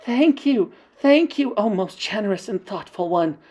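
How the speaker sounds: put-on high, fawning chorus of old witches; witches' chorus. Ancient witches thanking their leader, high and fawning. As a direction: gush your thanks to the leader. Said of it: "chorused the ancient witches".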